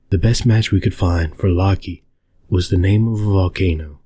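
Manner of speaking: usual speaking voice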